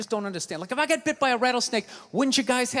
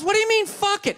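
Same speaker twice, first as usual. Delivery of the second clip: indignant; 8 semitones higher than usual. Retold or imitated. Girlfriend indignant.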